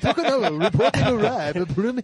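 hammed-up Japanese accent reporter; mock Japanese reporter accent. South Park Japanese reporter bit.

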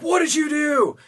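irate squeal of an indignant little man; fuming mad. Acted. Angry dwarf confronts the driver who hit him, fuming mad. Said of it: "he's fuming mad".

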